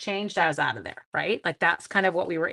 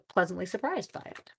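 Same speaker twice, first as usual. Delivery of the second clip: usual speaking voice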